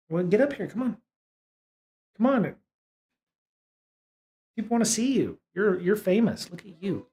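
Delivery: calling the cat to come up and say hello, coaxing. Real, not acted. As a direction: coax the cat up gently.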